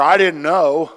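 put-on loud, high child's plea; kid excuse. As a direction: play the caught kid pleading innocence.

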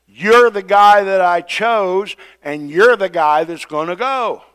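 loud, high and slow, you're the guy hammered home; angry God. Retold or imitated. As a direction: lay down the law on the chosen one. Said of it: "God said".

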